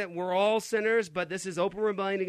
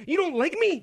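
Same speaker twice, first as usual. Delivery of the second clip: high-pitched mock whine; whiny, childlike; 12 semitones higher, 4 dB louder than usual. Host repeats the culture's response in mockery, whiny. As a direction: play the hurt child, pitched way up. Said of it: "it's this whiny, childlike response".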